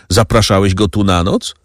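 plain reading voice

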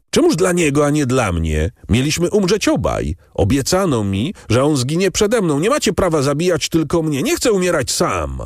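high, desperate outraged protest; wrzask protestu. A narrator's reading. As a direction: cry out against the injustice, pitched high. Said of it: "wrzasnął Andrea, wyrwany całkiem z odrętwienia". (rozpaczliwy, wysoki krzyk protestu)

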